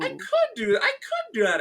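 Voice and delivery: very high, laughing realization; light up at the idea; excited realization. Retold or imitated. Hearing the friend's idea, high laughing realization.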